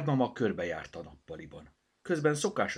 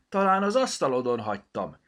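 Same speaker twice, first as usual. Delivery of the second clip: loud, raised-voice call; 7 semitones higher, 10 dB louder than usual. A narrator's reading. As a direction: call it out to someone nearby. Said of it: "kiáltotta". (hangosan odakiáltva)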